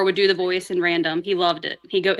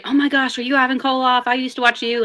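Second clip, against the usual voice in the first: higher-pitched imitation of an excited fan; starstruck fan; 5 semitones higher than usual. Fan approaches wrestler at dinner, imitated starstruck excitement. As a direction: gush like a fan spotting an idol. Acting out fans recognizing her grandfather.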